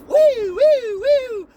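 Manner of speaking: high, loud siren woo; mock alarm siren cry; siren wail. Imitated brain sirens.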